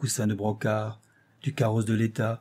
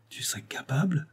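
hushed, high, horror-struck question; horrified stammer; 5 semitones higher, 4 dB quieter than usual. A narrator's reading. Lover learns she would have him killed, horrified. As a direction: whisper it as if petrified. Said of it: "balbutia Saint-Renaud, pétrifié d'horreur".

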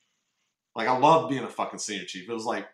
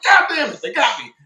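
much higher, much louder mock defeat; mock exasperated yell; 12 dB louder than usual. Realizing his own words came back around, a mock exasperated yell. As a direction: throw up your hands loudly, caught out.

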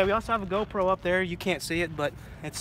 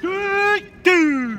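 umpire shout; 11 semitones higher, 9 dB louder than usual. Acted. Mock umpire call.

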